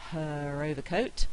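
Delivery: "her overcoat" with an R linking the two words; slow "her overcoat", R sounded before the o. Shown as a demonstration. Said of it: "I'm likely to say the R".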